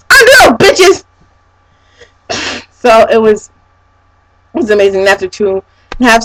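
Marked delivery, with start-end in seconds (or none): 0.1-1.0 loud, high cheer; hyped fan shout. Real, not acted. Fan cheering Underoath to viewers, hyped shout.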